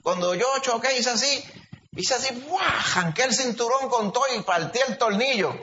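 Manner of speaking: high, excited retelling of his own feat; mock-proud storyteller, pitched well up; bragging survivor. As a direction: play the boastful guy who survived the crash. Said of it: "hay gente que dice".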